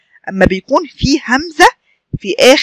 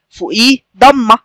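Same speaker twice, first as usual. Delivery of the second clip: ordinary delivery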